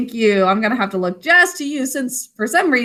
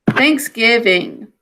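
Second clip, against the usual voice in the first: slow dictation; 2 semitones lower, slower than usual. Shown as a demonstration. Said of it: "write Thanksgiving".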